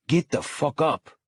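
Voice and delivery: commanding through gritted teeth; clenched teeth. Acted. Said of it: "I command through clenched teeth".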